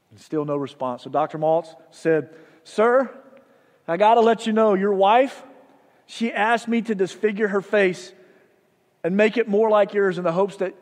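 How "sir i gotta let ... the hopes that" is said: calling out again. Retold or imitated. Doctor calls through door again.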